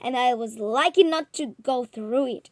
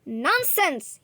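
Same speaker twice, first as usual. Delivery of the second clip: sharp, louder outcry of scorn; cried out; 5 semitones higher, 5 dB louder than usual. A narrator's reading. Professor dismissing the journalist's claim, scornful cry. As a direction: fling the word out in contempt. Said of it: "he cried".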